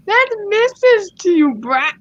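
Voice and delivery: high, indignant mock scolding; entitled mom. Entitled mom corrects the skater, high mock indignation. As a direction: play an affronted, bossy mother. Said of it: "here comes the voice actors".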